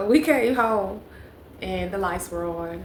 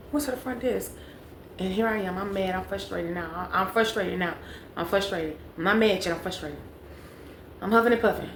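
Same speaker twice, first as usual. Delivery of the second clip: ordinary talk, nothing marked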